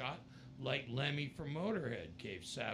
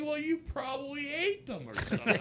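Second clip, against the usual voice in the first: higher, laughing teasing line; laughing joke; 8 dB louder than usual. Retold or imitated. [name] joking to Lemmy about the lost shoes, laughing. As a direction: tease him through laughter. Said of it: "I'm like".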